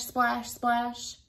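ordinary delivery

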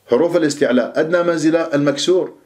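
normal talking voice

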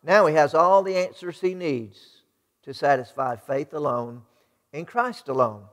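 ordinary delivery

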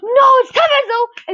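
gasp, then high, loud burst; excited correction. Real, not acted. Gamer spotting Tevez instead of Aguero, excited outburst. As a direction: blurt it out, pitched right up. Surprise at a pack reveal.

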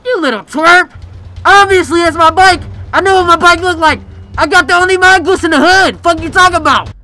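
angry yelling. Acted. Gangster yelling at the kid over his mongoose bike, angry shout. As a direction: shout him down, pitch up and loud.

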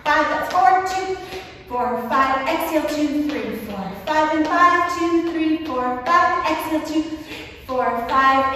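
high, pumped count of breath reps; counting workout reps. Shown as a demonstration. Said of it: "I'm actually gonna count it out for you".

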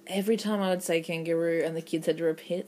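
normal talking voice

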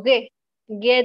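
"ge" dicho gue, como en guerra; g dura de guerra. Shown as a demonstration. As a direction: pronuncia "ge" con g dura. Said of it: "recuerden que se pronuncia como ge". ("ge" suena gue, no como jota)

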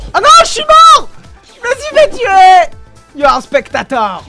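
ordinary delivery

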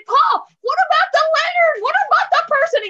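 high, loud, urgent questions; alarmed child's plea. Acted. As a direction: cry out to Pa in alarm. Child pleads for stranded person.

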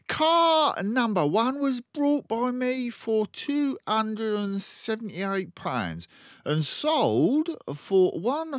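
loud, high, very slow dictation; slow dictating father. Acted. Father dictating sums to his son, slow and loud. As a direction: spell out each word for the boy.

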